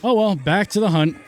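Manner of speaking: ordinary talk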